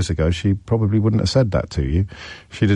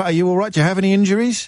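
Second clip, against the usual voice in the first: hammed-up worry, higher; mock concern; 9 semitones higher, faster than usual. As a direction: fuss over him as if he's hurt. Host pretends caller may be hurt.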